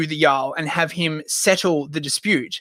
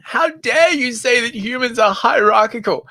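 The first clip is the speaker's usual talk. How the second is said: higher-pitched mock outrage; indignant activist; 6 semitones higher than usual. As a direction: put on a scandalized protester's voice. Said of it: "S J Ws ringing".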